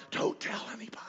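high, hushed stage whisper; hushed, conspiratorial warning; secretive whisper. Acted. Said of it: "does it kinda secretly".

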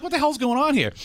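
high-pitched mock indignation; retold bafflement. Retells baffled reaction to the hug.

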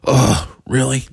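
high, exasperated groan of disbelief. Retold or imitated. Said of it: "I'm like".

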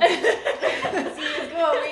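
laughing. Real, not acted. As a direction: agree while cracking up. Laughing at the story. (riendo, más fuerte y agudo)

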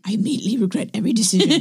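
fast, rattled-off regret; rapid deadpan. Real, not acted. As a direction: race through it, deadpan. Hungover regret quip, rapid.

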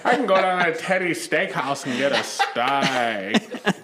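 slow, exaggerated Queens drawl; hammed-up King of Queens accent. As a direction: stretch the vowels in mock New York. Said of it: "that one accent I'm talking about".

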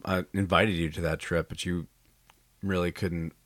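normal talking voice

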